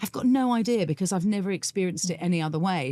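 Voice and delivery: ordinary talk, nothing marked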